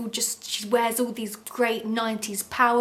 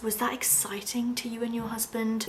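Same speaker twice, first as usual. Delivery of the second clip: whispered lawyer question; 5 dB quieter than usual. Retold or imitated. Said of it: "so he asks".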